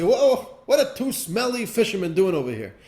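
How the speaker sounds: loud, high guard's challenge; guards stopping them. Acted. Castle guards stopping the fishermen at the moat, loud and high. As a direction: bark out a halt at the smelly fishermen. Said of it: "They say".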